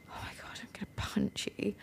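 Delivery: retold whisper of irritation; whispered threat. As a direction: breathe it as a mock threat.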